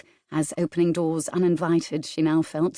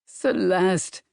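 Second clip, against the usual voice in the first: pleading. Acted. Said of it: "But this time with a pleading tone".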